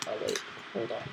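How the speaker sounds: ordinary talk, nothing marked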